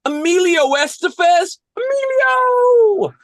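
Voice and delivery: put-on high, excitable squabbling kids; whining kid hockey players. Kids on a team with no coach, high and dramatic. Plays kids in Mighty Ducks.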